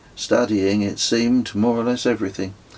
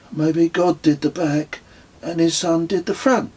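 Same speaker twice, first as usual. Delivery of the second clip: lightly voiced Kez, pitched higher; a hint of a wry friend; Kez's voice; 8 semitones higher than usual. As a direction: toss off a dry joke in a higher voice. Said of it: "said Kez".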